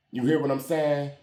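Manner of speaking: ordinary talk, nothing marked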